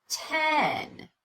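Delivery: "Ten" drawn out on a high, gliding pitch; pitch change. Shown as a demonstration. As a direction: stretch the vowel of "Ten" so the pitch change shows. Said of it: "Listen for that change in pitch".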